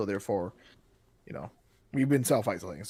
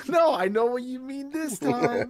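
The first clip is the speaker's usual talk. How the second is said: high, drawn-out mock protest; knowing refusal; 10 semitones higher, 3 dB louder, slower than usual. Man propositioned twice catching on, high protest. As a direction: wail a comic refusal slowly.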